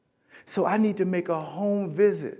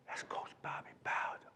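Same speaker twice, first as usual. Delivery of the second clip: gasp, then an unvoiced whisper; hushed, starstruck recognition; awed whisper; 17 dB quieter than usual. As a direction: breathe the name in awe. Awed recognition of famous coach.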